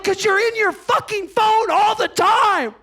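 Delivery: high, shouted mock tirade; mad. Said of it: "Why are you getting so mad".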